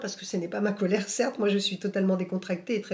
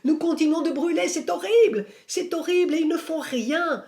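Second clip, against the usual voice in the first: higher and louder, a retold voice in torment begging for help; anguished cry of pain; 8 semitones higher, 5 dB louder than usual. Medium on her knees pleading for the dead, anguished higher cry. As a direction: cry out as a burning soul pleading, pitched high. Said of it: "tombe à genoux en se tordant de douleur".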